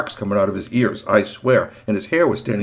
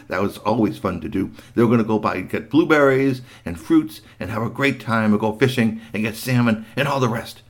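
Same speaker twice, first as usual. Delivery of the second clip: plain reading voice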